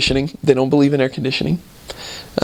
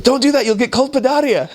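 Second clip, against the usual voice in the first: imitating Italian man; 11 semitones higher than usual. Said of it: "and he's like".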